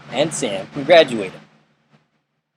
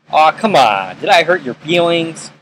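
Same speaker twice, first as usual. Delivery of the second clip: mock sympathy; 4 semitones higher, 4 dB louder than usual. Dad taunts his son before roughhousing, acted mock sympathy. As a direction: needle him with imitated mock concern, pitch rising.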